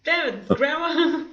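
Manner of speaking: slow, laughing "damn it"; laughing mock exasperation at Grandma; laughing curse. Real, not acted. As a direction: groan it through a laugh. Laughing exclamation.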